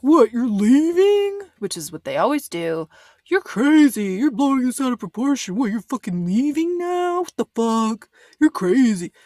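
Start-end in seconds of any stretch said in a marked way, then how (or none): 0.0-1.4 slow, high, put-on incredulous voice; mock indignant boyfriend. Mimicking the boyfriend as Lexi walks out, slow mock disbelief. Boyfriend reacts as she leaves. 3.3-9.1 high put-on voice, dismissive and scornful; mock gaslighting boyfriend. Mimicking the boyfriend calling Lexi crazy, scornful put-on voice.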